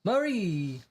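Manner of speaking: bellow. Acted. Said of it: "bellows Enrico".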